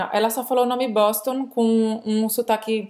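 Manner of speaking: normal talking voice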